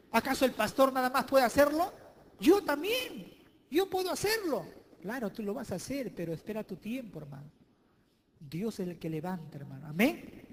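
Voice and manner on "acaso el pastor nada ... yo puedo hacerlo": high, mocking me-too whine; a rival insisting he can do it too, higher; jealous claim. Preacher mimicking a jealous congregant, much higher. Voicing a jealous church member.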